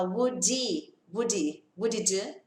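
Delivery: "would he" linked as "wud-i", the H silent; dropped H. Shown as a demonstration. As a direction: glide "would he" together with no H sound. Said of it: "O som do H some".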